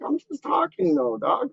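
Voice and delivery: high-pitched mock protest; retold protest. Retold bickering, protesting to dad.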